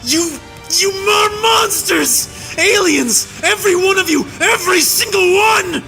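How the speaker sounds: high shouting, cracking with anguish at the peaks; a soldier screaming out what he witnessed; anguished rage. A narrator's reading. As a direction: let grief and fury break through the yell. Tirade over remembered horrors.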